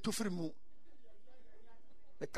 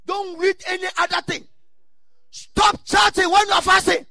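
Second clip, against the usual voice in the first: loud preacher shouting; preaching shout; 8 semitones higher, 13 dB louder than usual. Real, not acted. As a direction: shout it from the pulpit. Preaching at full force.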